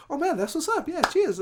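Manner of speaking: very high, friendly retold switch; suddenly cool. Angry rapper switches on meeting the stand-in DJ, suddenly cool. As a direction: imitate someone abruptly turning nice, laughter in the voice. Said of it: "he just switched and he was cool".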